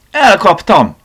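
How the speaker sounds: high, loud cry of triumph; jubilant, ringing yell; joyful shout. A narrator's reading. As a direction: shout it out, delighted at the catch. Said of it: "kiáltotta felé örömmel Tivadar".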